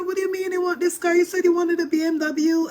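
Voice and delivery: high-pitched, rushed disbelief; incredulous mother. Retold or imitated. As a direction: imitate a mom demanding an explanation. Retold mother, incredulous at son.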